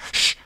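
sharp hushing "shh"; sudden warning shush; urgent shush. A narrator's reading. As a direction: hiss for silence. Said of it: "seized his comrade's arm and said".

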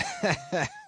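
high, short scornful chuckle; mocking stranger's laugh; solemnly derisive laugh. A narrator's reading. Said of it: "a solemnly derisive sort of laugh".

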